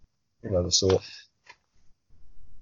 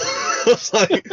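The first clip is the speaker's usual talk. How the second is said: laughing; 12 semitones higher, much slower than usual. Real, not acted. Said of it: "laughing through it".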